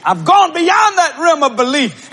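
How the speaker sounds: loud, high preaching build to knowing; preaching at full force. Acted. Preacher proclaiming to the congregation, full force. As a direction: preach it out with fire.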